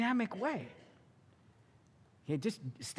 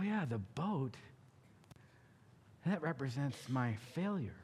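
soft confession; 7 semitones lower, 7 dB quieter than usual. Acted. Peter confesses to Jesus quietly.

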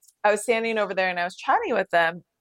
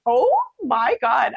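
shocked gasp; 5 semitones higher, 6 dB louder, much slower than usual. Retold or imitated. Retold shock at racist remark.

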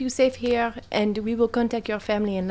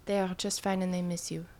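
softer, tender reassurance; gentle reassurance; 3 semitones lower, 10 dB quieter than usual. Acted. Player reassures the frightened girl, soft and tender. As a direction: soothe her softly.

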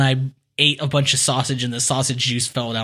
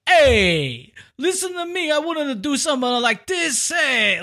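high, indignant mock-Italian crime boss; upset crime boss, mock accent; 9 semitones higher than usual. Crime boss confronted by police, indignant in mock-Italian accent. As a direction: whine angrily in a hammed-up mock-Italian accent. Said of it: "he's all upset. He's all angry".

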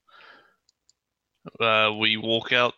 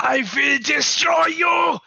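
put-on slow furious shout in a mad-scientist German accent, much higher and louder; angry German accent; 6 dB louder, slower than usual. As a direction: bellow it like a raging mad scientist. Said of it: "The angry one".

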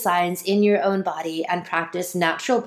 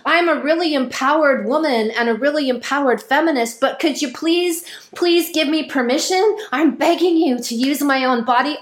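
mock begging; 6 semitones higher than usual. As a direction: beg like a pathetic supplicant, raised pitch. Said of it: "screaming and begging and pleading".

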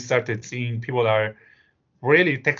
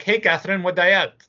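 put-on higher-pitched cheerful old lady calling out; elderly woman; 7 semitones higher, 4 dB louder than usual. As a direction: voice a chatty elderly lady greeting her daughter. Old woman calling her daughter.

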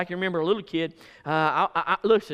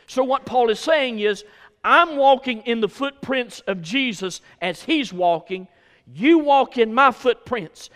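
raised, forceful pulpit cadence; fervent preaching; 4 semitones higher, 5 dB louder than usual. Real, not acted. Preacher urges church to follow Christ, fervent preaching. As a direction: punch the key words and stretch the final phrase.

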